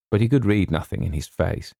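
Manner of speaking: ordinary delivery, nothing marked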